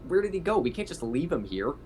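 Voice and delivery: ordinary talk, nothing marked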